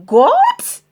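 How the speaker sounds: Goat? squeaked up in disbelief; shocked. Acted. Pirate chief appalled by goat stew, shocked Goat?.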